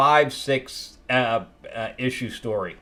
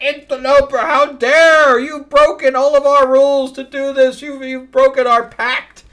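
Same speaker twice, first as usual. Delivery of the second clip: much higher and louder outraged scolding; mock furious guardian; 14 semitones higher, 9 dB louder than usual. Library guardian rages at the intruder, high loud mock outrage. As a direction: blast the intruder for breaking the pact.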